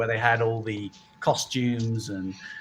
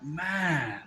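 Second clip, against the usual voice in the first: mock passerby; 6 semitones higher, 6 dB quieter, much slower than usual. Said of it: "I won't do the accent".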